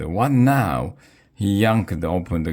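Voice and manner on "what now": raised, exasperated dad voice; mildly hollered exasperation. A narrator's reading. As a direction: holler it, fed up with interruptions. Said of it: "my dad hollered".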